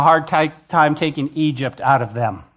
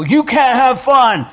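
much higher and louder, slow mocking scold; mocking a strict tyrant god forbidding fun, high, loud and slow; heavenly tyrant; 8 semitones higher, 6 dB louder, slower than usual. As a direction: ham up a killjoy tyrant god. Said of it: "a heavenly tyrant looking at you go".

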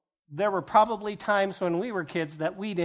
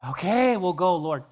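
louder okay we'll go; reluctant yes; 6 dB louder than usual. Acted. Disciples finally agreeing to go, louder. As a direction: give in at last.

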